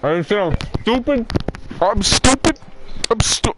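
high, put-on dopey voice; silly dumb voice. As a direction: play dumb in a goofy high voice. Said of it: "The way you fucking said it".

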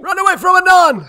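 yelling in alarm; panicked shout. Real, not acted. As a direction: shout a frantic warning.

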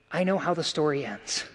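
I know how the story ends, said softly and down low; soft quoted refrain. Retold or imitated. Quoting the song's refrain softly.

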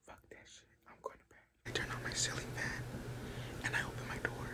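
very soft night-time whisper. Real, not acted. Giving up on the fly at bedtime, whispering. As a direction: keep it hushed, all in whisper. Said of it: "why am I whispering".

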